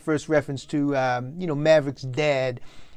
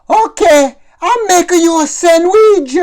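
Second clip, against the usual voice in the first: much higher, louder mother calling out; calling old mother; 13 semitones higher, 13 dB louder, slower than usual. Acted. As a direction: shout sweetly from the kitchen like an old mom. Mom calling to son.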